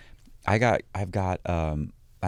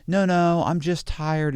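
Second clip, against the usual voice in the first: mocking girlfriend; 9 semitones higher, slower than usual. Mimicking girlfriend refusing his visit, mock-whiny. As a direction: imitate her excuse, pitched up. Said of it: "And she".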